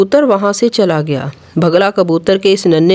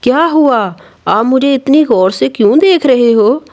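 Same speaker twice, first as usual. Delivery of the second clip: put-on higher, innocent child voice; innocent little chick; 4 semitones higher than usual. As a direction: ask it like a naive baby bird. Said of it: "बड़ी मासूमियत से बोला".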